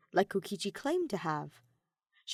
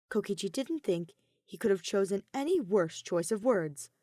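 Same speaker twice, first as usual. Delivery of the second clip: ordinary delivery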